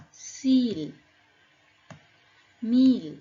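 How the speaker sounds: "ea" in each word said as a long E, as in "Season"; E sound demo. Shown as a demonstration. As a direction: read words like "Tea", "Beak" singly, stressing the long E. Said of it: "only E sound only we pronounce".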